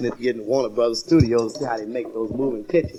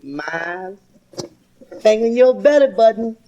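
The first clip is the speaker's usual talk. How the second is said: put-on teasing boy; 7 semitones higher, 3 dB louder than usual.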